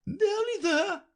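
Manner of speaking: queen repeating. A narrator's reading. Queen echoes Alice while dragging her, high and loud. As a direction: throw her words back at her. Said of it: "the queen repeated".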